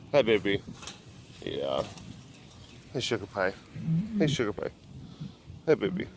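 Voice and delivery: soft, low cooing to a cat. Real, not acted. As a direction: sweet-talk the big cat gently.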